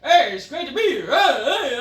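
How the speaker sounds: loud, high mock-cheery greeting; cheesy Orton. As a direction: overbright fake wrestler cheer. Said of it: "this guy is just like".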